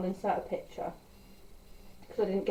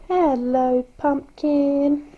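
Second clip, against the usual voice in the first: cooing to the dog; 6 semitones higher, 11 dB louder, much slower than usual. Real, not acted. Greeting her dog Riley in a cooing pet voice. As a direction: coo it at the puppy.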